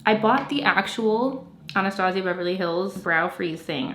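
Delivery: ordinary delivery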